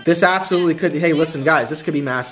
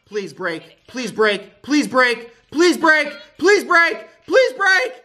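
rising pleading; 8 semitones higher, much slower than usual. Real, not acted. Begging the wheel.

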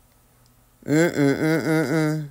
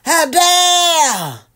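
indignant mock outrage; 7 semitones higher, 5 dB louder, much slower than usual. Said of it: "he said".

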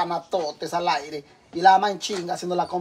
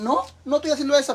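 higher, quick whiny denial of the sons; mocking denial; 6 semitones higher, faster than usual. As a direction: mimic the sons brushing it off. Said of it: "Y lo niegan".

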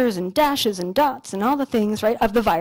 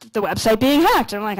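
normal talking voice

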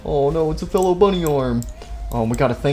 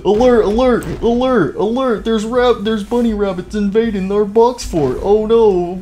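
high, urgent mock alarm; alarm call; 6 semitones higher, 3 dB louder, slower than usual. Player warning of bunnies invading the fort, high alarm. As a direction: sound the alarm over and over.

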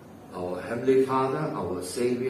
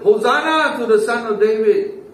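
high, loud cry of acclaim; retold crowd shout; 11 semitones higher, 9 dB louder than usual. Preacher retells the crowd hailing the Son of David, high loud shout.

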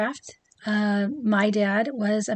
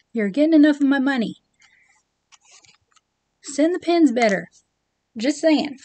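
real exasperated rant, raised voice; 5 dB louder than usual. Real, not acted. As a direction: let the frustration pour out, louder. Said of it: "sorry, my rant".

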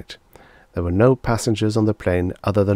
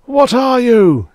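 slow, very high, loud scream; 10 dB louder, slower than usual. A narrator's reading. Lawyer struggling against the belt, screaming. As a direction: scream it, drawing out the words. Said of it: "screamed Mister Bradbury".

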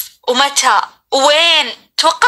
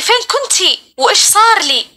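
retold weeping mother, higher pitch; mildly acted distressed question, pitched up; tearful questioning; 6 semitones higher than usual. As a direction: imitate a mother asking through tears, lightly. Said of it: "أمي صارت تبكي وتحضني وتسألني".

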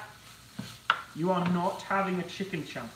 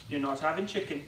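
ordinary talk

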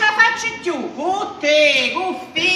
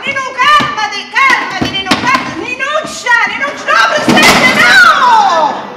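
loud, higher, pleading shouts; frantic shouting; 6 semitones higher, 8 dB louder, slower than usual. Acted. Mother screaming at her raging daughter, frantic.

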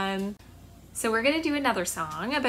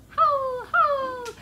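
high, drawn-out howl; wolf howl; 13 semitones higher, 4 dB louder than usual. Shown as a demonstration. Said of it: "wolves howling".

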